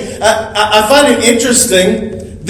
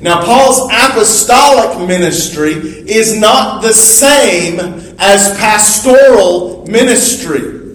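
ordinary talk, nothing marked

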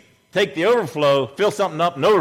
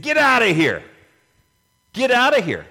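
loud, higher-pitched order to leave; commanding shout; 7 semitones higher, 5 dB louder than usual. Acted. Preacher acts Jesus clearing the house, commanding shout. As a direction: throw them out with a raised voice.

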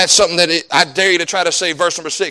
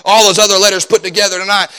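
ordinary talk, nothing marked

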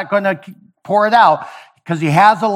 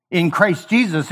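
ordinary delivery

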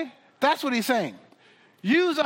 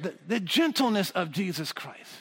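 softer; 8 semitones lower, 6 dB quieter than usual. Shown as a demonstration. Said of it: "repeated phrase".

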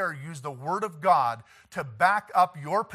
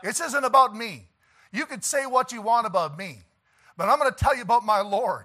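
high, loud preacher's rebuttal; impassioned defence; 5 semitones higher, 5 dB louder than usual. Acted. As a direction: stand up for your Lord with raised voice. Acting out defence of his Lord.

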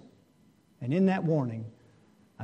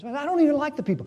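higher and fast, a petulant mock gripe; mock complaining churchgoer; 10 semitones higher, much faster than usual. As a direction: imitate a sulky member, rushed and high. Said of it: "I'm just giving illustrations".

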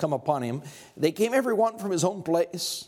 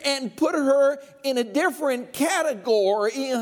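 full-force preaching; 7 semitones higher than usual. Acted. Pastor pressing the men on Job's wife, preaching hard. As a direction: preach it up to the rafters.

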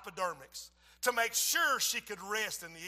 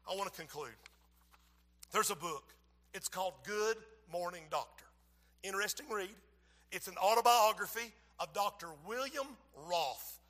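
ordinary delivery

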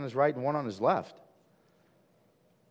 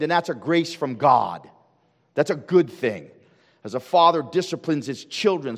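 full-force preaching; 6 semitones higher, 9 dB louder than usual. Acted. Preacher calling out to his church in full-force preaching. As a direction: drive it home from the pulpit.

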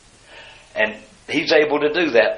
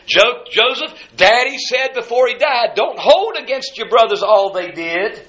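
high-pitched, halting start, then insistent; pleading brothers; 9 semitones higher than usual. Retold or imitated. Joseph's brothers after their father's death, pleading in high voices.

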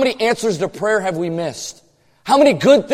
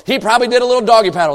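fast comic aside; 4 semitones higher, 4 dB louder, much faster than usual. Acted.

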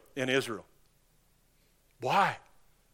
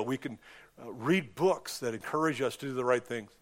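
soft, earnest prayer voice fading low; closing prayer; 3 semitones lower, 3 dB quieter than usual. Acted. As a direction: pray quietly to the Father, winding down. Closing prayer after sermon.

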